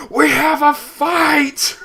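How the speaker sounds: high, loud slow alarm shout. Retold or imitated. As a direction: yell it out to the whole school. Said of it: "my cooperating teacher just go".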